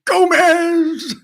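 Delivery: loud, very high strained yell; Uncle Fester. Acted. Uncle Fester calling Gomez, high loud mock shriek. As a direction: shriek the name, stretched out and hammed up. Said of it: "that was my shitty Uncle Fester impression".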